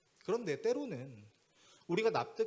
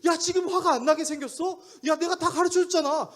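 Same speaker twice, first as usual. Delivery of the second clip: high-pitched, loud blow-up; angry scolding; 12 semitones higher, 10 dB louder than usual. Retold or imitated. Pastor snapping at young man he already coached, angry. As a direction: let the exasperation burst out. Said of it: "왜 근데 저한테 화를 내세요".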